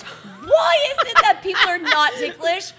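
mock outrage. Real, not acted. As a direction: act scandalized. Mock outrage at not ticklish.

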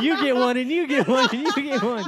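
high excited giveaway shout; Oprah giveaway. Pretend. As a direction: hand out prizes like a host. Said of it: "like, Oprah".

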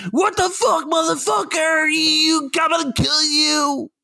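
high screamed rage, drawn out; exaggerated pissed off. Mocking how angry parking would make him, over-the-top furious scream. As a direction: scream it like an unhinged road-rager, way overdone. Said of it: "something I'm about to be like".

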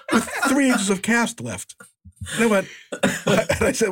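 slow words broken by laughter; laughing. Real, not acted. Telling friends about the cut-down cast, slow laughing delivery. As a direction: let the laugh carry it slowly. Laughing at his own story.

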